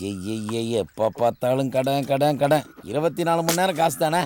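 low, irritated grumble; weary grumbling. Acted. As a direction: complain in a low, fed-up voice. Woken man grumbles about debts.

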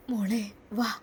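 soft whispered call. Acted. Mother whispering to her little girl to come along. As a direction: hush it, just breath. Mother quietly calls daughter.

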